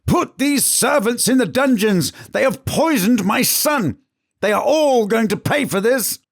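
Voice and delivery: queen raging at the servants over her son; screaming. Acted. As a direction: scream the threat through tears. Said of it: "The queen screamed".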